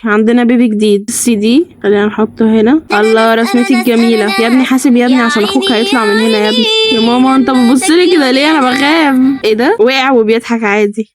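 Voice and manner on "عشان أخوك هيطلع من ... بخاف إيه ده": ordinary talk, nothing marked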